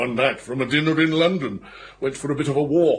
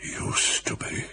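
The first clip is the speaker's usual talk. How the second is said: breathless whispered question, caught off guard; shocked whisper; 8 dB quieter than usual. Acted. Egbert whispering in shock at Tipton's ruin. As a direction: let it slip out as a stunned whisper.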